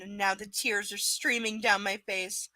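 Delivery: ordinary delivery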